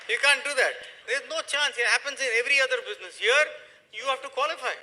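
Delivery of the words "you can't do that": ordinary talk, nothing marked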